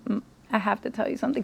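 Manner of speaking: hushed, quick, tentative murmur; hesitant confession. Retold or imitated. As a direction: softly ease into difficult news. Retelling hesitant call to dad.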